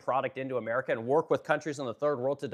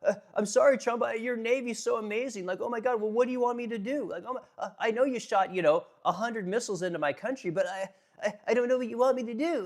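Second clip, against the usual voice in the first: higher, stammering, mock-nervous voice; mock-flustered Iran answering Trump, pitched up; flustered apologizer; 8 semitones higher than usual. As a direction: fumble and apologize, caught off guard. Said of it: "They're just like".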